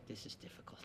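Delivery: hushed, so the neighbour can't hear; a wife whispering this is difficult on the plane; whispered aside. Retold or imitated. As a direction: whisper it under your breath.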